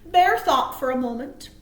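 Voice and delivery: plain reading voice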